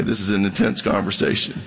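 ordinary talk, nothing marked

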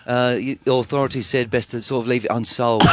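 a hint of a British rock musician's accent; British accent impression. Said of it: "That's a very good accent".

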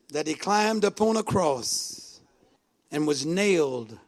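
low, grave voice with long pauses; hushed solemn preaching. Real, not acted. Preacher recalls Jesus forgiving from the cross, hushed.

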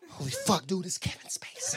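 unvoiced shocked whisper on waking; a startled hushed whisper to a friend. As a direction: whisper it in stunned disbelief. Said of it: "Imagine waking up to that".

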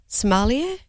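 "Somalia" said like the country, guessing at sommelier; wrong pronunciation. Real, not acted. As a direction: mispronounce it as "Somalia", unsure. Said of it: "I don't even really know how to say it properly".

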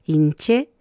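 hinché said slowly, weight on the last syllable ché; drawn-out hinché landing on the final é; stress on the end. Shown as a demonstration. Teacher modelling a word, slow hinché stressed at the end. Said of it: "al final de la palabra".

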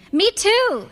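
high-pitched, delighted mother; eager chiming in. A narrator's reading. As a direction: jump in eagerly, pitch leaping up.

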